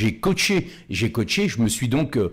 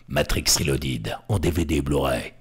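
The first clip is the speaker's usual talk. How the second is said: deep booming trailer announcer; low, weighty trailer narration; movie trailer voice; 9 semitones lower than usual. Shown as a demonstration. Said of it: "Tu vois ce genre de voix un peu grave". (voix de bande-annonce grave)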